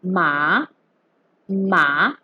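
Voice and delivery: MA starting low and sweeping up; long rising "ma", like a question; second tone. Shown as a demonstration. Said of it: "ma with the second tone".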